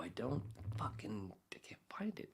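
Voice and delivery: muttered under the breath while searching; hushed annoyed mumble; whispered mutter. Real, not acted. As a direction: whisper to yourself, frustrated.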